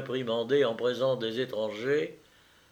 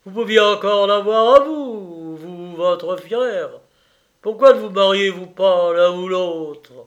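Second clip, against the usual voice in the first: loud, higher, insistent colonel; exclaiming colonel; 5 semitones higher, 10 dB louder than usual. Acted. Colonel urging brother and sister to marry, loud exclaiming. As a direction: press them loudly with the question.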